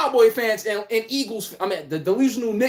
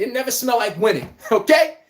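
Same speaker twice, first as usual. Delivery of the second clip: ordinary talk, nothing marked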